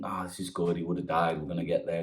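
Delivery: soft, low musing to himself; quiet inner thought. Acted. Frog thinking to himself while swimming, softly. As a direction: think it under your breath.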